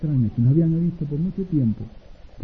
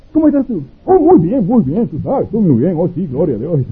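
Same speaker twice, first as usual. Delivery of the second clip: cheery phone greeting; 3 semitones higher, 8 dB louder than usual. Acted. Cheerful phone greeting between friends.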